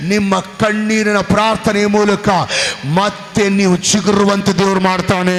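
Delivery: loud, high, rising sermon shout; full-force preaching. Acted. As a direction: preach it to the rafters.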